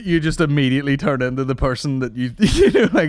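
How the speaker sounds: ordinary delivery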